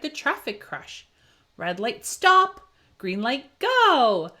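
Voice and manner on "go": loud high go; cheerful shout of go; called-out go. A narrator's reading. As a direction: call it out like a starting signal. Traffic light chant.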